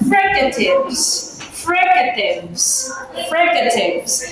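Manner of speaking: fricatives said slowly, every syllable spelled out; slow drilled fricatives. Shown as a demonstration. Teacher drilling the word fricatives slowly for the class. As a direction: stretch out fricatives so students catch each part. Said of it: "let's have the alveolar".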